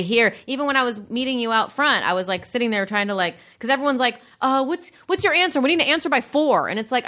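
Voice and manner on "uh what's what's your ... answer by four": pushy demanding voice. Retold or imitated. Mimicking pushy email senders.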